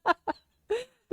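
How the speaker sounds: high, slow words through laughter; laughing disbelief. Real, not acted. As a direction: gasp it out while laughing.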